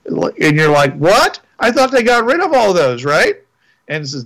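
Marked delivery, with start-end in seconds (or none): 1.0-3.4 surprised. Retold or imitated. Player reacts to surprising mission.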